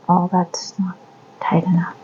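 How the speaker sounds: soft whispered muttering to self. Real, not acted. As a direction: whisper it under your breath. Whispered complaint about stitch.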